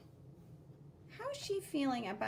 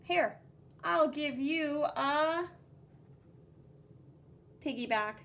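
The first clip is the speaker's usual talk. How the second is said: put-on a touch louder, kind kid's voice; reassuring kid; 2 semitones higher, 3 dB louder than usual. Blue kid reassuring his tired friend, kind voice. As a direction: comfort her lightly. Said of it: "And he says".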